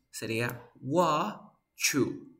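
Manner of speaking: "wa, chu" split slowly, t and y fused into ch. Shown as a demonstration. Teacher breaks the blended sound apart so students hear the ch. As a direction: stretch "wa" then land on "chu". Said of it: "van a crear el sonido ch".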